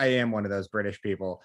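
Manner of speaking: low mock-irritated reply; exasperated Brit's retort. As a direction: imitate an injured man's weary correction. Injured Brit's retort, acted.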